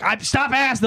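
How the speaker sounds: put-on exasperation; fed-up retort. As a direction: imitate a man sick of questions. Arrested man fed up with questions.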